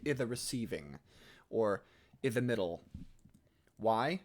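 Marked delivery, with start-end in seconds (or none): 0.1-1.0 "i'" as a short "ih" with no n; squished elision. Shown as a demonstration. Coach demonstrating the dropped N, "i'" as short "ih". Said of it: "squish it down to make it". 2.2-2.8 "i'" as a short "ih", run into "the"; squished elision. Shown as a demonstration. Coach giving another example, "i'" as short "ih". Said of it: "squish it down to make it".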